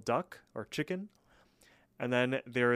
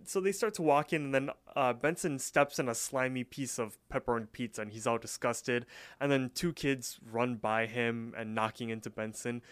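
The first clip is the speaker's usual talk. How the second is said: normal talking voice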